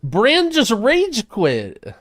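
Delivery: slow, high, loud disbelief; outraged yell. Real, not acted. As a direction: drag it out, yelling. Companion quits, streamer yells.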